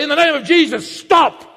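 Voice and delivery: forceful preacher's rebuke; commanding rebuke. Shown as a demonstration. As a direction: command it to halt with authority. Demonstrates commanding the waves.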